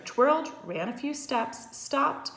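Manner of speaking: ordinary delivery, nothing marked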